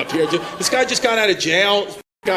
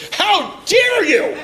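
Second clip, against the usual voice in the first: high, slow, affected "How dare you?"; mocking a man's prissy retort; 12 semitones higher, 4 dB louder, much slower than usual. Man snapping at his wife in a prissy mock voice. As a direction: camp it up, drawn out and way up high. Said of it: "And then he goes to her, he goes".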